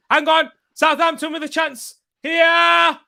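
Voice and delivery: high, loud live match call; excited shouting. Real, not acted. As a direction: react to a chance on goal.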